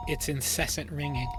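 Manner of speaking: plain reading voice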